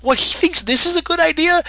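hammed-up shocked squeak; mock disbelief. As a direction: act stunned your brother thought this was good. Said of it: "And he's like".

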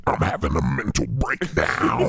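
slow, loud, eerie voice; creepy slow voice. Acted. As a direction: say it slow and unsettling. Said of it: "That one actually freaks me out".

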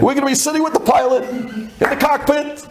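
high-pitched, insistent bragging; defiant boast. Retold or imitated. Passenger promising agent he'll sit with the pilot, defiant.

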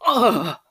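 short, loud moan of pleasure; pleasured moan. Acted. Narrator acts out a moan.

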